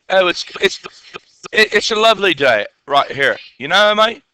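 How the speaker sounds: mock English accent slipping into Australian; accidental Australian accent. Teacher joking with the class, wobbly Australian accent. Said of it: "I think I just went to Australian".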